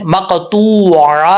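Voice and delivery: slow مقطوع with a true ain, no hamza; clear ain recitation. Shown as a demonstration. Teacher warns students, slow مقطوع with ain. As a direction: stretch مقطوع from the throat. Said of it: "تو آپ نے عین کی ہی آواز نکالنی ہے".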